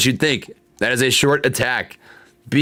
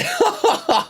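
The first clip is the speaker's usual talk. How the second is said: high squeaky laugh at his own slip; embarrassed laugh. Real, not acted. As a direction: laugh sheepishly after swearing. Laughs after slipping a swear.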